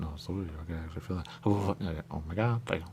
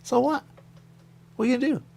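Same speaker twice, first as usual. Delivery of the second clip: defiant; 7 dB louder than usual. Retold or imitated. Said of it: "I go".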